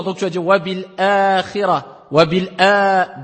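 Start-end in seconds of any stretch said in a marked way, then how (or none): 0.4-2.1 "wa bil akhira" with the middle A held two beats; prolonged hamza vowel. Shown as a demonstration. Teacher to students, holding the A of "wa bil akhira" two counts. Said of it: "ça t'indique deux temps". 2.1-3.2 slow "Wa bil a," with the final A drawn out two beats; two-beat vowel. Shown as a demonstration. Teacher to students, drawing out the A of "Wa bil a," slowly. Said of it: "deux temps".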